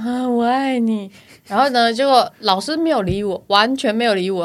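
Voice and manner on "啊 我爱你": slow, dazed "我爱你" with eyes shut; dreamy drooling confession. Retold or imitated. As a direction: drool it out slowly, half asleep. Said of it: "然后我就这样".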